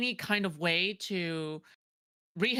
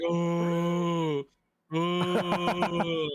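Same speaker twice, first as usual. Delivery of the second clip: low, droning mock "oh"; depressed Kermit impression; 5 semitones lower than usual. Mocking the co-host's low voice, depressed Kermit drone. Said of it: "you sound like you're going like".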